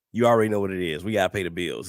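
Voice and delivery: usual speaking voice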